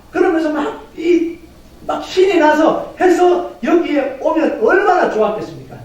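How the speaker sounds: high, exultant sermon delivery; fired-up preaching. Acted. Preacher imagines a joyful testimony to congregation, fired-up preaching. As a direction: proclaim it triumphantly, pitched well up.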